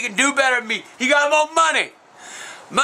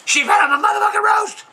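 very high-pitched, shouted disbelief; high indignant squawk; outraged yell; 9 semitones higher than usual. Real, not acted. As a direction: holler it, voice leaping up. Outraged about his roast.

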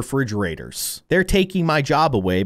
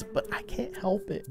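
very soft, higher aside; hushed confession; 4 semitones higher, 12 dB quieter than usual. Pretend. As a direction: shrink into a tiny helpless admission. Confessing insensitivity.

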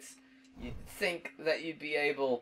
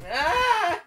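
loud, high-pitched shriek; startled scream; 11 dB louder than usual. Real, not acted. Player caught out in a game, startled scream. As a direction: shriek in sudden alarm.

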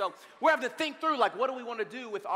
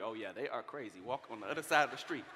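hushed aside; 12 semitones lower, 8 dB quieter than usual. Retold or imitated. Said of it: "you're like".

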